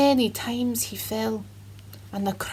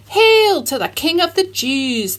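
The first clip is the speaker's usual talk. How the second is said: higher, louder mock hail; mocking soldiers; 6 semitones higher, 7 dB louder than usual. A narrator's reading. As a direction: jeer it like cruel soldiers. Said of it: "they mocked".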